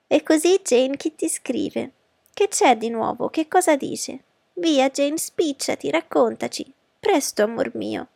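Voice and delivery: high, eager, pressing questions; impetuous questioning. A narrator's reading. Mother pressing her daughter about the letter, impetuously. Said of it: "chiese impetuosamente".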